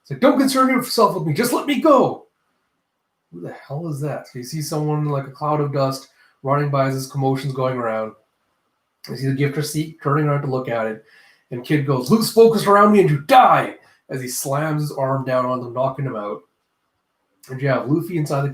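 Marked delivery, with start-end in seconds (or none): 0.2-2.3 high, loud pleading outburst; mock agitated protest. Acted agitated reply to pursuer. 12.1-13.8 loud, raised battle cry; mock fierce threat. Kid's threat as he attacks.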